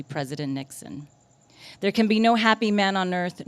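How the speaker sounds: emphatic protest poem, punching each phrase's opening; poetry reading. Acted. Poet denouncing President Nixon to a live audience, emphatic declamation. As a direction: declaim it like an indictment of a leader.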